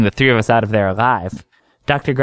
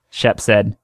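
ordinary delivery, nothing marked

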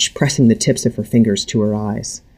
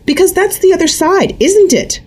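talking fast; 4 dB louder than usual. A narrator's reading. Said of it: "talking fast".

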